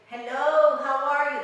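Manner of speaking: sing-song Mexican accent on hello, lightly done; a hint of a Mexican accent, melodic greeting; Mexican sing-song English. Shown as a demonstration. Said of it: "their accent is like singing".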